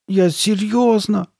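high, drawn-out tearful insistence; slow high whine, close to tears; almost crying. A narrator's reading. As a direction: plead it slowly, on the verge of tears. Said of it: "чуть не плача".

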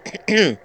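pointed owl cough; throat clearing. Acted. Owl about to lecture Vasilisa, clearing throat. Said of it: "прокашлялся Филин".

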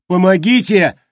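loud high wail for help; wailing cry for help. A narrator's reading. A captive screaming for help inside Glimung, loud wail. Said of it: "возопил другой".

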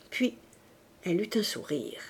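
ordinary delivery, nothing marked

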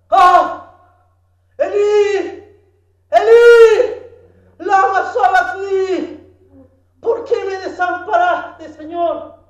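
high, loud cry of abandonment; anguished cry. Retold or imitated. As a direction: cry out in agony to the Father. Jesús clama desamparado en la cruz. (clamor agudo y angustiado)

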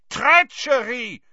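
high, drawn-out furious bellow. A narrator's reading. Karkaroff accusing Dumbledore, bellowing. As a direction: shout it slowly in outrage. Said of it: "he bellowed".

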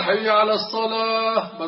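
حي على الصلاة called high and slow like the muezzin; call to prayer. Shown as a demonstration. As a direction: chant it like a muezzin. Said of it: "بدل أن يقول". (نداء المؤذن)

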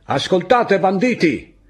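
a high, loud yell carried up the slope; enemy soldier shouting up from below; shouting from afar. Acted. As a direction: bellow it across the hillside at the bandits. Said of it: "qualcuno gridò".

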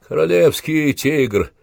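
ordinary delivery

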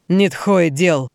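slow, harsh, spat out; spat out angrily. Acted. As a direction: spit the words with hatred. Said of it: "злобно выплюнул он".

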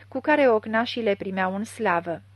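ordinary delivery

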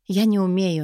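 usual speaking voice

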